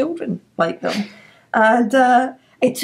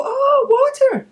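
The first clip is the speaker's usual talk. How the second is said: much higher "Oh" of mock realization; imitated sudden recognition; 3 dB louder than usual. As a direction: play someone finally getting it. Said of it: "And they go".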